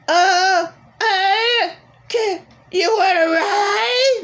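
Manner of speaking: high, slow, sing-song mockery with a laugh; mocking whiny voice. Mocking her ex asking if she wants a ride, high whiny parody. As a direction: mimic him like a pathetic whiner.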